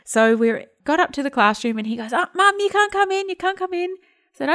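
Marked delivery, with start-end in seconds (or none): 2.1-3.9 put-on child's voice. Son voiced in a retold story.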